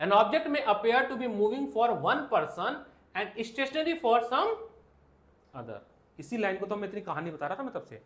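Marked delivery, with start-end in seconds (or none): 0.1-5.8 reading the NCERT line aloud word by word; drawn-out dictation to the class; slow textbook reading. Shown as a demonstration. Teacher reading the textbook line slowly to students.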